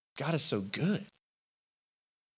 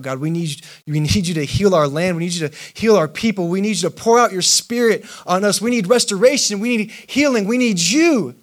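loud, rising plea, higher and louder; crying out; 5 semitones higher, 8 dB louder than usual. Acted. Crowd begging God to heal their land, loud pleading cry. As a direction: beg God at full voice, building.

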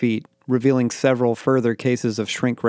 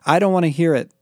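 higher, mildly yelled refusal; yelled over him; 6 semitones higher, 3 dB louder, faster than usual. A narrator's reading. Becky refusing to hear Rob's message, mildly yelling. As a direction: cut off the message in protest. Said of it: "Becky yelled over him".

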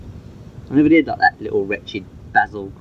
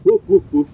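mock woofs, higher and louder; imitated dog bark; 8 semitones higher, 4 dB louder than usual. Shown as a demonstration. Owner barking to coax the dog, mock woofs. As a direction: bark to show the dog how. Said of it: "Bark like a normal dog".

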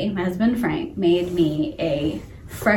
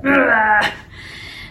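imitated vomiting sound; mock retching; 3 semitones higher than usual. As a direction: heave as if vomiting into a toilet. Acts out vomiting over toilet.

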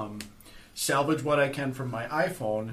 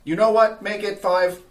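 loud, high robotic pronouncement; 7 semitones higher, 9 dB louder than usual. Acted. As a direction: deliver it like a stiff machine boss. Robo grants more.

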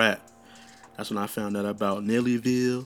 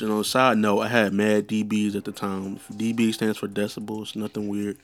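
normal talking voice

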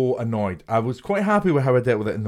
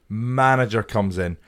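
ordinary delivery

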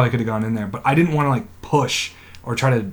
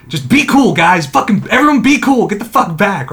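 urgent crowd control; 7 semitones higher, 5 dB louder than usual. Retold or imitated. Retold warning to gathering crowd.

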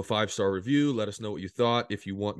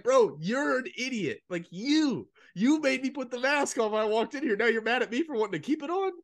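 mock indignant; 11 semitones higher than usual. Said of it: "And I was like".

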